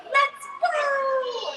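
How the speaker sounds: high, bright little pig voice; Peppa voice. Acted. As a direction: squeak it like a small piggy. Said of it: "says Peppa".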